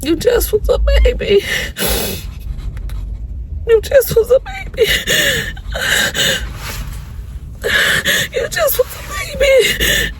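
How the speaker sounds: high, quiet, tearful pleading; crying. Real, not acted. Mother crying over her son growing up, weepy. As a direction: sob through the words, voice breaking. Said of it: "I really wanna cry".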